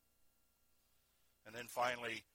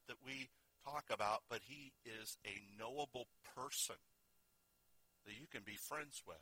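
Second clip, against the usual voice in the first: gentle altar call. Acted. Quiet altar invitation, heads bowed.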